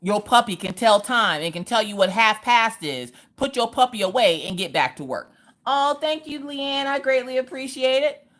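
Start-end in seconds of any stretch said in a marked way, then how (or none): 5.7-8.3 sarcastic thanks. Real, not acted. Sarcastic thanks to chat viewer.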